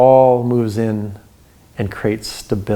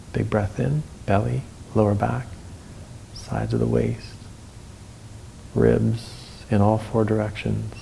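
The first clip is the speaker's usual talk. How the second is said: guided breathing. Acted.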